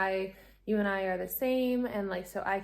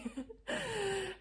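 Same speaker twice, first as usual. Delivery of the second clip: breathy high laugh; 12 semitones higher, 9 dB quieter than usual. Real, not acted. Laugh after retold sobbing.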